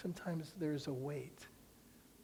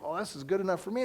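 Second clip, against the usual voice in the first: eager brush-off; 4 semitones higher, 6 dB louder, faster than usual. Retold or imitated. Girl eager to leave.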